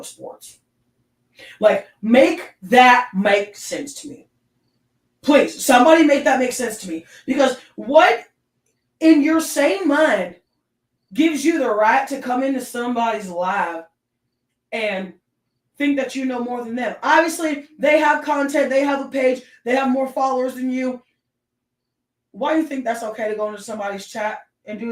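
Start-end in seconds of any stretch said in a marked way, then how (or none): 1.6-13.3 exasperated rant. Real, not acted. Ranting at sexist commenters. 15.8-23.5 heated rant. Real, not acted. Ranting at sexist commenters.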